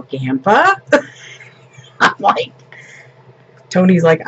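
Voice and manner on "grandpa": put-on high, loud questioning toddler voice; imitating a toddler. Little boy told to say Grandma, asking in toddler voice. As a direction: answer like a little boy, pitch swooping up. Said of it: "and he'd go".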